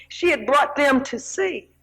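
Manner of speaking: ordinary talk, nothing marked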